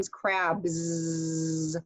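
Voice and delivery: "crabs" said very slowly ending in a buzzing Z; Z-sound ending. Shown as a demonstration. Teacher to students, modeling the Z ending slowly. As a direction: stretch the voiced Z at the end of "crabs". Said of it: "sound like a Z".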